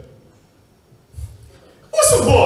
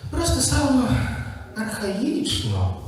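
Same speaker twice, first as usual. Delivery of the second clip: a touch of snobbish disdain, softer and slow; tossed off carelessly to the side; careless aside; 6 semitones higher, 6 dB quieter, slower than usual. Acted. Elder son sniffs at his parents' pig slaughter, softly careless. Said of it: "сказав недбало в бік".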